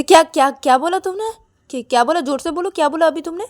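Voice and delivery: high, sharp repeated "what"; incredulous challenge. A narrator's reading.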